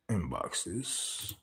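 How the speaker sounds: normal talking voice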